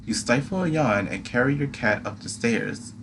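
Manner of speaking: usual speaking voice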